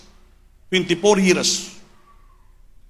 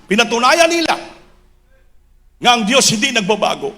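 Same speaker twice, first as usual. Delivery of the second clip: high, impassioned preacher's proclamation; full-force preaching; 11 semitones higher, 4 dB louder than usual. Real, not acted. Preacher extolling the pastors' perseverance to the church, full-force preaching. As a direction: build the list of sacrifices to a shout.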